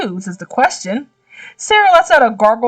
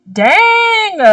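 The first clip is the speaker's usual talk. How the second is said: startled exclamation; 12 semitones higher, 4 dB louder than usual. Real, not acted. Almost face plants into him.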